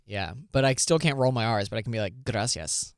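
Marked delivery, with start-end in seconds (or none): 2.2-2.9 "Gracias" with the R only half rolled. Shown as a demonstration. Showing a co-host the accent, "Gracias" with a half-rolled R. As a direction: try rolling the R in "Gracias", not quite managing it. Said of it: "sounds like you're half rolling your".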